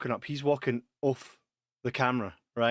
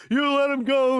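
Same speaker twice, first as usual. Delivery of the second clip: mocking accusation; 12 semitones higher, 5 dB louder than usual.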